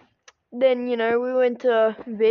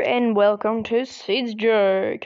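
ordinary talk

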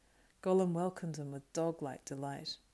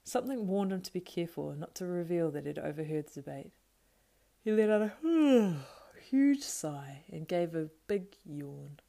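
ordinary delivery, nothing marked

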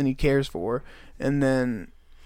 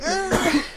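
high startled mock shriek; retold mom's shocked yelp; shocked scream. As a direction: gasp out a shocked cry. Mom shocked at sight.

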